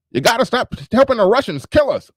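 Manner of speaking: high mock paranoid warning; imitating RBR. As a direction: pitch it up like the ranting guest. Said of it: "he's still".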